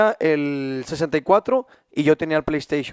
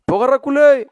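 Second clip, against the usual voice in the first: higher, louder yelled question; called-out question; 8 semitones higher, 6 dB louder than usual. Retold or imitated. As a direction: shout it across the house. Friend shouting question from afar.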